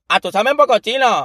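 high, put-on voice of a Chinese waitress; mock waitress voice. Friend imitating the waitress's surprised reply, high.

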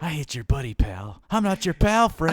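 escalating South Park bicker, half laughing; South Park quote. Retold or imitated. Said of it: "South Park".

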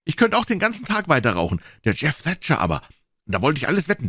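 put-on high-pitched boys' voices; high, eager kid chatter; boys bragging, excited. A narrator's reading. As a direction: play boys crowing over a rival.

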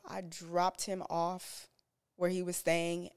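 ordinary delivery

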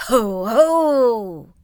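loud booming ho ho; booming windy laugh; big gusty laugh. Acted. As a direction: laugh like a gust of wind. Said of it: "he laughed a big gusty laugh".

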